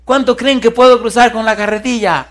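high, loud call to a crowd; hammed-up performer's challenge, pitched high; acted showman. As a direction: imitate a daredevil working up the audience. Acts tightrope walker addressing crowd.

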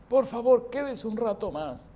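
súplica ronca y aguda, imitada; voz ronca. Retold or imitated. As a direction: imita al enfermo rogando con voz ronca. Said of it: "Así con su voz ronca me dice". (ruego con voz ronca)

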